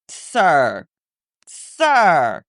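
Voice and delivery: low, loud, drawn-out reproach; exasperated sir. Real, not acted. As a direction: groan it at him, fed up. Exasperated at the lawyer.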